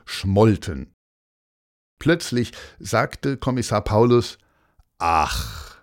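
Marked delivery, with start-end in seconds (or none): 5.0-5.8 very low, drawn 'oh'; low, heavy little 'ach'; dawning realisation. A narrator's reading. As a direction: let it drop deep as it dawns on you. Commissioner's sudden sigh of realisation.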